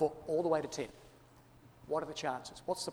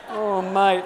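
Australian oh mate; 3 semitones higher, 9 dB louder than usual. Pretend. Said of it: "never trust an Australian, eh?".

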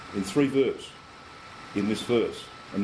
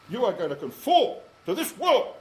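a hint of a pushy bully, higher; imagined stranger gripping your arm and ordering you; mock forceful enforcer; 7 semitones higher, 3 dB louder than usual. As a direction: play someone pushing you into line. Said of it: "get you by the arm and say".

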